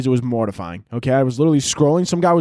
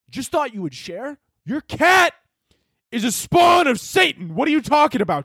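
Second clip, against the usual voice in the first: high, mock-appalled rant; incredulous outrage; 11 semitones higher than usual. Scolding Deborah over her cat, incredulous outburst. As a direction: build from hushed disbelief to a high, stretched shout.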